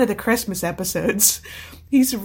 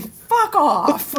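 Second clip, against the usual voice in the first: higher, curt brush-off; mock dismissive; 7 semitones higher than usual. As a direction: wave it away like a friend teasing. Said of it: "her response to everything".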